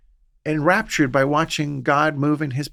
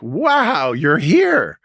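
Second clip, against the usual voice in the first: surprised; 5 semitones higher, 6 dB louder, much slower than usual. Pretend. Waking to find Jesus there.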